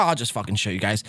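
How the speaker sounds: normal talking voice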